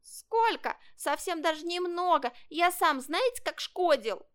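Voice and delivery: high little kitten voice; protesting kitten. Acted. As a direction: insist eagerly in a high voice. Kitten defends the boy. (котёнок горячо возражает высоким голоском)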